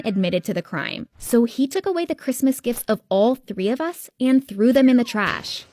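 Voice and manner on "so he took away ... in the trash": ordinary delivery, nothing marked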